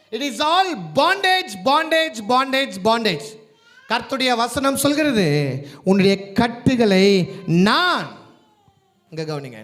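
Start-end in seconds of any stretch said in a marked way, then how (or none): 0.1-8.2 loud, drawn-out proclamation; full-force preaching. Real, not acted. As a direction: proclaim it loudly, stretching key words. Preaches a scripture promise at full force. (முழு வீச்சில் உரத்த பிரசங்கம்)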